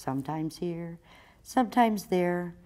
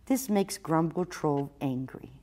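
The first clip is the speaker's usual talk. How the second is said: ordinary delivery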